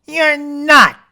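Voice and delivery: high, loud snapped retort; snapping rabbit. Acted. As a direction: snap back at the bear. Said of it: "snapped the rabbit".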